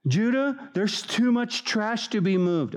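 high, nagging whine to the leader; whiny complainer. Retold or imitated. Complaining people nagging Judah, high and whiny. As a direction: pester Judah with a gripe.

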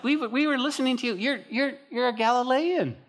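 high-pitched, stammering accusation; accusing servant girl. Acted. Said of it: "And so the servant girl's like".

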